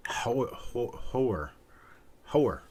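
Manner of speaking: Boston accent, "Hoer" with the r dropped into a vowel. Shown as a demonstration. Host echoes Carl's Boston accent, saying "Hoer". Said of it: "What was the Boston accent?".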